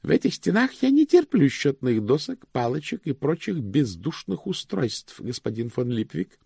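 high, formal voice for a precise clerk; ceremonious high-voiced bank official; high-pitched pedantic chief cashier.

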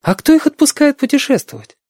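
put-on high-pitched girl's questions; amazed girl. A narrator's reading. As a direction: ask in wonder, lifting to a girl's high pitch. Girl amazed children may travel. (изумлённая девочка, высокий голос)

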